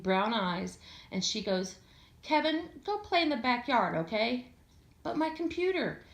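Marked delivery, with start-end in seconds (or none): 2.2-4.5 put-on higher, patient mom telling her kid off; a light touch of a gentle but firm mother; lightly voiced mom shooing Kevin off; mother's voice. A narrator's reading. Gwen sends her son away. 5.0-6.0 put-on higher, whining little boy; lightly voiced protesting kid; a hint of a kid pleading with mom; whiny kid. A narrator's reading.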